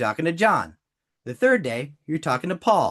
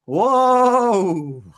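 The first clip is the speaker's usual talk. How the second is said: drawn-out, raised "whoa" that slides down; long loud whoa; 6 semitones higher, 5 dB louder than usual. Real, not acted. Coach floored by John's pain points, long whoa. Reacting to sad pitch.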